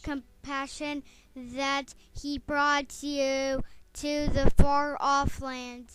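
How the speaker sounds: loud, slow, reassuring frog voice; the frog comforting the weeping mouse; comforting Magic Frog. Acted. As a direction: soothe the crying mouse. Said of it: "said Magic Frog".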